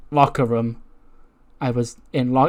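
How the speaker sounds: ordinary talk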